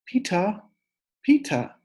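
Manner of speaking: put-on high little-kid call; toddler calling. Fudge calling his brother, high toddler voice. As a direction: call him like a small child. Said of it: "until he called".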